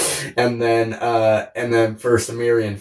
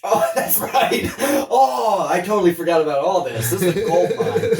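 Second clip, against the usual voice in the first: excited; 6 semitones higher than usual. Real, not acted. Delighted remembering the joke.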